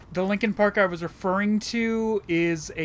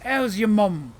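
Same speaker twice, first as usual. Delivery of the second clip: broad Cockney, louder greeting; over-the-top Cockney; 5 dB louder, slower than usual. Shown as a demonstration. Player asked for a British voice, hammed-up Cockney. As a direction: ham it up like a cartoon Cockney. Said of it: "or Cockney accent".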